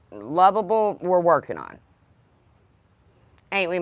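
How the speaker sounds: normal talking voice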